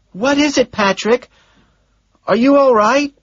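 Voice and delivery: a secretary passing on messages; Gene's voice. Acted. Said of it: "she asks".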